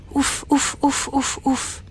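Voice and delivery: soft, even whispered puffs; relieved puffs. Acted. Narrator imitates the dragon's relieved heart, softly puffing. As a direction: breathe out relief in little beats.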